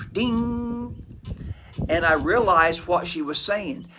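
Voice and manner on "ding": a high sung ding like a bell; ping it out like a sudden realisation bell; mock chime. Holy Spirit sparks his realisation, playful high ding. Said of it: "the Holy Spirit went".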